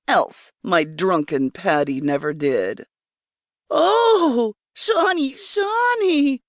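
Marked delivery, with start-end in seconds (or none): 3.7-6.4 high, drawn-out cry of the name; high ecstatic outcry. A narrator's reading. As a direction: call out in rapture, pitched well up. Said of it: "I cried out".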